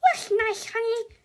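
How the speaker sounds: very high, airy mom voice; sweet distracted mum. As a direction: answer without really listening. Mum answers absently.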